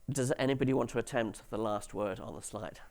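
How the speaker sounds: ordinary talk, nothing marked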